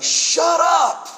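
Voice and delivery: high, loud mock holler; imitated yell. Condo neighbor to man praying loudly, imitated yell. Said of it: "he yells out".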